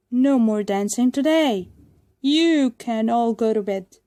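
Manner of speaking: booming command to the puppets; loud order. A narrator's reading. Puppet master ordering the puppets, loud. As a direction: send everyone off to bed firmly.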